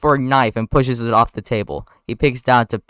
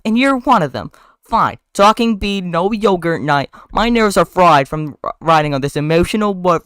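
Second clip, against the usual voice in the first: loud, high, exasperated woman; acted breakup rant, pitched up; Vanessa, fed up; 7 semitones higher, 5 dB louder than usual. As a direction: vent loudly at a partner. Vanessa angrily breaks up with Ken.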